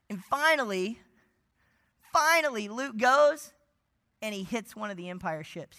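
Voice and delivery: normal talking voice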